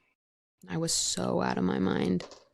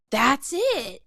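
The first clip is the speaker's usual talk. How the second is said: higher pitched, mildly excited exclamation; retold realization; 7 semitones higher, 4 dB louder than usual. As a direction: replay a sudden discovery, pitched up. Retold moment of sudden realization.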